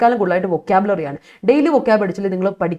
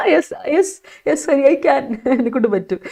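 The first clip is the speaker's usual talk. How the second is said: laughing reply; 4 semitones higher than usual. Real, not acted. Laughing back at student comments.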